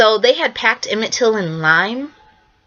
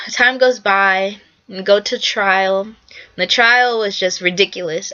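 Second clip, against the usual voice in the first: ordinary talk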